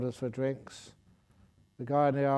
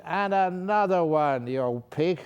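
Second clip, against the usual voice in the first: louder, higher, slower mock insult; rude demand; 5 semitones higher, 5 dB louder than usual. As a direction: bark the order like a rude drunk. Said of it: "Really being rude".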